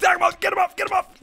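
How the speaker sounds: high, frantic battle calls; high-pitched excited orders; urgent urging. Real, not acted. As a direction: urge the attack on way up high. Urgent attack in game.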